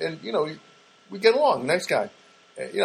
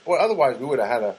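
ordinary delivery

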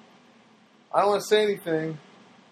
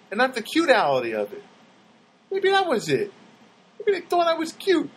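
giddy realization; 8 semitones higher than usual. Retold or imitated. His own giddy reply to neighbor.

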